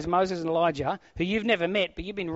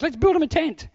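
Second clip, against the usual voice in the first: high, loud mocking take on the idea; mock Peter suggestion; 11 semitones higher, 8 dB louder than usual. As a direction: ham up the absurd tent idea. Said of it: "the first thought you're gonna have is".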